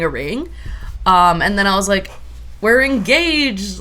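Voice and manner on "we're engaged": retold happy news, high and drawn out; excited announcement. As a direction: announce it loudly and slowly to the room.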